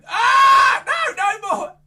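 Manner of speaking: very loud high cry; hammed-up panic; mock alarm. Magician to kids, loud high mock panic. More wands appear.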